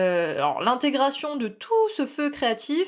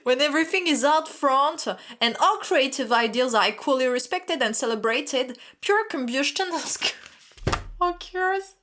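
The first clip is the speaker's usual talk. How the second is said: higher, reading English with a French accent, fighting laughter; 4 semitones higher than usual. Shown as a demonstration. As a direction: read the card in English, nearly cracking up. Reads card text in English.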